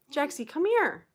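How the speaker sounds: high, loud call across the room; calling the dog. Real, not acted. Owner calling her dog Jaxie over, loud and high. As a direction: call "Jaxie, come here" loudly and high, falling on "here".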